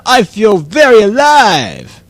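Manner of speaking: stretched-out mock elation; elated. Guest telling David about new stores, elated. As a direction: draw it out with playful excitement.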